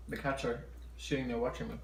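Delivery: ordinary talk, nothing marked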